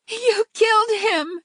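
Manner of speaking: wife shrieking over her husband on the ground. A narrator's reading. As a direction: wail it like a hysterical wife. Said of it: "she shrieked".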